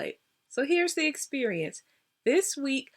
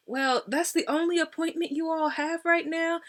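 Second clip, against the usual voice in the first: higher questioning voice, acted out; incredulous caller; 7 semitones higher than usual. Patient to scheduler about the only appointment, incredulous. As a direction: question it in mock disbelief, pitched up.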